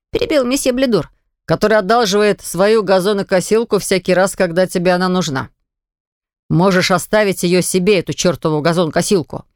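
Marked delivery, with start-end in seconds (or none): none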